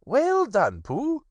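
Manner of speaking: high, kindly rabbit voice; high voice, kind praise. Acted. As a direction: pat him on the back as Rabbit. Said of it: "said Rabbit kindly".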